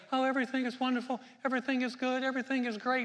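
high, fast sing-song mock optimism; mock cheerful denial. Preacher mocking Christians who pretend all is fine, chirpy mock cheer. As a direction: parody fake chirpy positivity. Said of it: "we're not out, out there saying".

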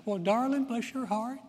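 sweet Southern mom. Retold or imitated. Mom soothes her hurt child, sugary high Southern coo. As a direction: coo it like a syrupy mother. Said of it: "she'd go".

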